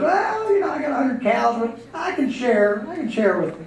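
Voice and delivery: put-on high, hesitant, placating old bull; nervous backing down. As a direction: give in meekly with the pitch jumping up. Older bull backs down, intimidated.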